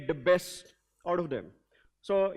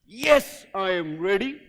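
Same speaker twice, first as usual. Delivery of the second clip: energetic shout; 2 semitones lower, 4 dB louder, slower than usual. Shown as a demonstration. I'm loving the energy.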